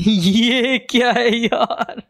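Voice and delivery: high, drawn-out laughing disbelief; incredulous laughing. Real, not acted. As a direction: laugh while asking in disbelief, stretching it out. Startled laughing at game document.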